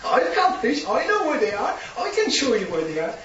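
high, loud preacher playing out the shore dialogue; preacher acting out Jesus calling to the fishermen from shore; preaching at full force. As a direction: shout it like a fired-up preacher.

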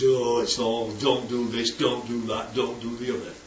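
low, soft droning list of complaints; mock a sceptic's weary litany of don'ts; grumbling non-believer. Preacher imitates a non-believer listing commandments, low mock grumble. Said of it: "the non-believer says".

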